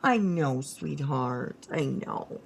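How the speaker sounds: tender murmur to a pet; soothing a pet. Real, not acted. Soothing the upset cat.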